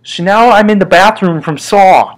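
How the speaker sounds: ordinary talk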